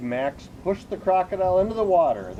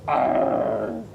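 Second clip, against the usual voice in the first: crocodile roar; 2 semitones lower, 4 dB louder than usual. Acted. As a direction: growl it like a crocodile. Loud sound heard in jungle.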